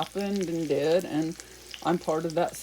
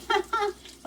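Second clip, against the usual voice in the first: high giggle. Real, not acted. Laugh after grandma voice.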